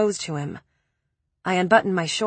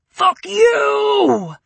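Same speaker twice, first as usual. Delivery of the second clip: much higher, louder, cracking into a crying shout; screaming; 13 semitones higher, 5 dB louder than usual. A narrator's reading. Preppy screaming to the ceiling, breaking into crying. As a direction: let the scream break into a sob. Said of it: "he screamed to the ceiling".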